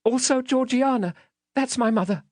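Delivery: high, timid little voice; timid child. Acted. Child pointing out his mother to the man, timid. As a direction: explain meekly, as a scared child. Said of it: "I timidly explained".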